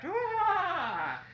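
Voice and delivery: high trumpeting hoot of a laugh; elephant's laugh. Acted. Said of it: "waved his trunk and laughed".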